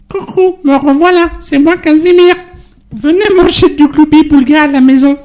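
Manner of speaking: put-on high children's TV monster voice; sing-song, very high character greeting; Casimir. Guest imitating Casimir on request, high friendly monster voice. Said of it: "je fais bien Casimir".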